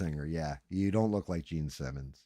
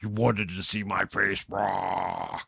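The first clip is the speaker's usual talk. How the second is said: loud, drawn-out mock demon roar; play-acted demon taunt, loud and theatrical; 5 dB louder, slower than usual. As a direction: growl it like a hammed-up demon, stretching the last word. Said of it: "demon voice thing and be like".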